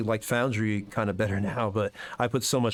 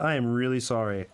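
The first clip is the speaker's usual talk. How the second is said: ordinary talk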